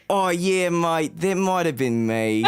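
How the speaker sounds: slow, drawn-out Australian delivery; Australian accent. Retold or imitated. New cop owning up to the partner, Australian accent. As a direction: put on an unapologetic Aussie accent. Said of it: "I'm in my fucking Australian accent".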